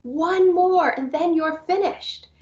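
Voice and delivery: high, bright sing-song coaxing, slowing at the end; upbeat prompting. Retold or imitated. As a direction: coax a child, high and cheerful. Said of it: "I was able to say".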